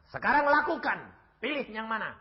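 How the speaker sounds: high, loud dare that eases at the end; daring challenge. Retold or imitated. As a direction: dare the killer to do it now. Retells daring the killer to act. (tantangan lantang bernada tinggi)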